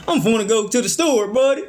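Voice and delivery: high, butchered hillbilly twang; playful, exaggerated hillbilly accent. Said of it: "Y'all got that hillbilly talk out here".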